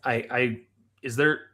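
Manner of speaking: ordinary delivery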